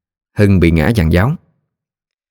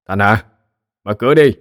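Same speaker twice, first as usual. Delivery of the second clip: put-on drunk voice; 2 semitones higher than usual. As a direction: slur it like a drunk calling out. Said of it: "kèm theo cái giọng lè nhè".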